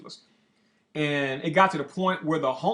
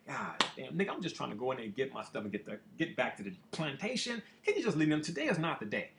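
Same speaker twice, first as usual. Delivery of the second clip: much softer, weary muttered brush-off; retold exasperation; 7 dB quieter than usual. Fed up with the bum's begging, muttering under his breath. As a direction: mutter it like you've had enough.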